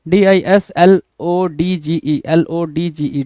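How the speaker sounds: letters spaced out for students; slow spelling. Shown as a demonstration. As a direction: spell each letter out slowly. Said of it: "dislodge".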